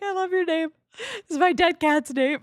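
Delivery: high-pitched, half-laughing imitation of her own tearful voice; retold sobbing compliment. Streamer retelling crying to Ellie about her name, mock sobbing. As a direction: squeak it out like you're crying again. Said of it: "sobbing, and I was like".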